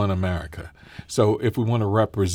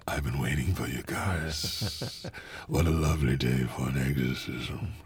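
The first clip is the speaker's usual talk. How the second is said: demonic double voice; 6 semitones lower, 6 dB quieter than usual. Shown as a demonstration. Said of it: "Well, can you do it?".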